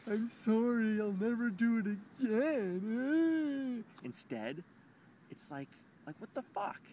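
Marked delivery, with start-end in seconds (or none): 0.1-3.8 high, loud, slow mock apology; hammed-up sorry voice; mock her pleading apology; imagined apologizing ex. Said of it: "she should have been like".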